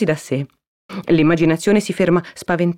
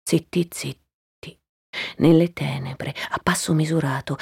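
hushed, stealthy; 4 dB quieter than usual. A narrator's reading. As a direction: whisper it as if creeping through the dark. Narrator hushes for creeping in dark. (sussurrato, furtivo)

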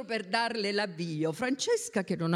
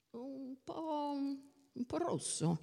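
soft, hesitant, higher voice; hesitant verdict; 5 semitones higher, 9 dB quieter, slower than usual. A narrator's reading. Answering a friend about the little dress, hesitant and soft. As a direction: hedge it softly, halting after the first words.

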